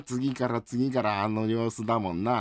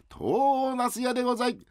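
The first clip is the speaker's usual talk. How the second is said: vendor's call again. Acted. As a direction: call it out once more for uncle. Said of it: "もう一回やって". (高く伸ばした売り声)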